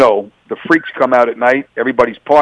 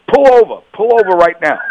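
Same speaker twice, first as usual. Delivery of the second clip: higher-pitched, insistent retold order; urgent command; 6 semitones higher than usual. Officer telling his partner to pull over, urgent. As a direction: urgently demand a stop.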